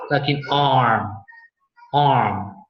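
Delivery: "arm" with a long open ah. Shown as a demonstration. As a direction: stretch the ah in "arm". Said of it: "a very long sound of ah".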